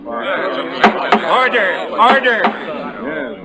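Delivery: high, ringing call for order. Acted. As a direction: bring the courtroom to heels. Judge gavels the court.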